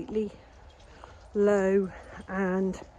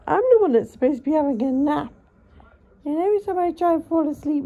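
higher, louder complaint; mock exasperated outburst; 6 semitones higher, 8 dB louder, faster than usual. Real, not acted. Owner complaining to the cat that she wants a nap, loud mock exasperation. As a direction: vent at the cat in mock outrage. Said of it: "said again louder".

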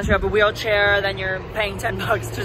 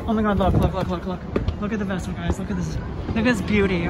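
ordinary talk

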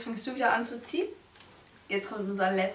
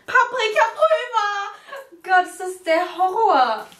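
high, loud, slow outcry of disgust; anguished wail; 9 semitones higher, 9 dB louder, slower than usual. Real, not acted. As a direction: wail it like a nightmare. Draws worst ingredient, wails. (gequälter, lauter Aufschrei)

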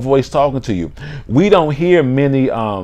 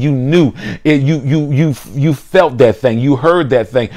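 usual speaking voice

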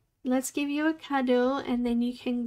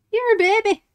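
higher, louder playful taunt; teasing sing-song; 8 semitones higher, 8 dB louder, faster than usual. Real, not acted. Teasing a younger viewer, a high playful taunt. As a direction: tease them in a sing-song.

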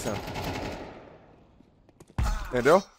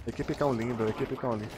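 low, soft self-talk; wary test reading; 5 semitones lower, 10 dB quieter than usual. Real, not acted. Streamer checks a shop name for trolling, wary test reading. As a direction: sound the name out quietly to yourself. Testing donated name for hidden trick.

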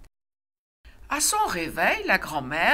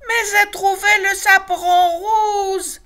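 excited insistence; 12 semitones higher, 8 dB louder than usual. A narrator's reading. Loupiote insists to papa.

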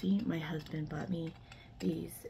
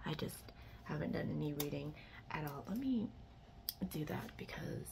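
whispering; 5 dB quieter than usual. Real, not acted. Whispering while others sleep.

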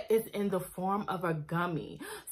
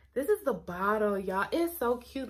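ordinary delivery

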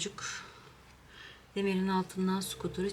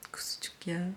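fond whispered coo; whispering; 9 dB quieter than usual. Real, not acted. Whispering fondly to cat.

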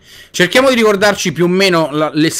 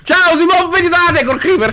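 mock cheerful greeting; 10 semitones higher than usual. Teasing friend about creeper.

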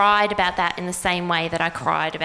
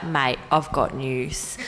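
low, slow, flat-toned imitation of Geraldine; deadpan announcement; 9 semitones lower, 6 dB quieter, slower than usual. Imitating Geraldine breaking big news, low and slow. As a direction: drop it low and drag it out, leaning back. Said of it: "Like that".

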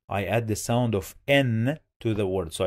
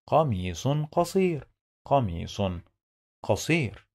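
connected reading; 3 semitones higher, much faster than usual. Shown as a demonstration. Teacher putting the phrase together, quick and connected. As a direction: say "قميص قصير" briskly as one phrase.

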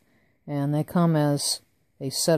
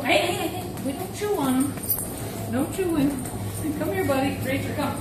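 high-pitched scolding; 9 semitones higher than usual. Real, not acted.

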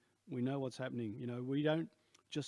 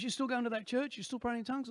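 much higher, eager questioning voice; lightly voiced old workmate; 9 semitones higher than usual. Ken asking on the phone, high and eager. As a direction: sound keen and curious. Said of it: "He goes".

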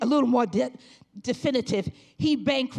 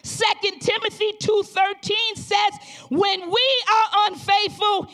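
loud, rising revival preaching; preaching at full force; 5 semitones higher, 4 dB louder than usual. Acted. Pastor exhorting the congregation, loud full-force preaching.

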